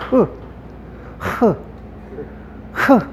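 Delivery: short breath-released "흐"; chi as "흐" sound. Shown as a demonstration. Teacher showing how some people read chi, isolated "흐" h-sound. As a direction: say "흐" as a rough h, each one separate. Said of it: "이걸 갖다가 흐로 읽어요".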